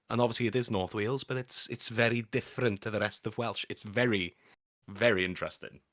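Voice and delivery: normal talking voice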